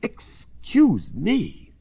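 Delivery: high, very drawn-out startled apology; exclaiming. A narrator's reading. Professor blown against Mary Poppins, exclaiming. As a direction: stretch it out as if blown off balance. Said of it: "he exclaims".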